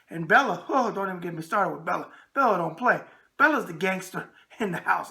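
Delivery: ordinary talk, nothing marked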